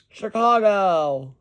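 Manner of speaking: slow, stretched Chicago; playful slow repeat; drawn out again. Acted. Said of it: "That was funny as heck".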